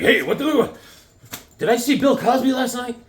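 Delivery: high, alarmed character voice; startled Jack. Acted. Jack wakes up drugged and confused, startled high voice. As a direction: blurt it out, high-pitched and bewildered.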